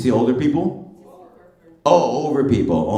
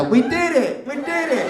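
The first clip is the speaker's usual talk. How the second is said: much higher, cheery cartoon-girl cheer; imitating Dora; 14 semitones higher than usual. Comedian doing Dora's end-of-show cheer, much higher and cheery. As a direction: shout it like a triumphant cartoon kid explorer. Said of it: "She went".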